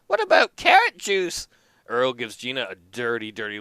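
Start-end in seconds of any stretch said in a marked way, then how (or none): 0.1-1.5 put-on higher, practical old wife; lightly voiced old wife. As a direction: chime in as a sensible old wife. Gina voice, much higher.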